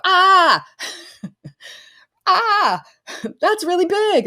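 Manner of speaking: high, loud giddy gasp with laughter; overwhelmed gasp. Retold or imitated. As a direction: play the client stunned by their own dream. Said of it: "they go".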